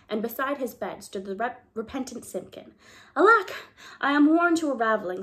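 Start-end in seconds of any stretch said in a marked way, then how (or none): 3.1-5.2 put-on higher, louder tired old man; the tailor waking, worn out but relieved; weary old tailor. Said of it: "said the Tailor of Gloucester".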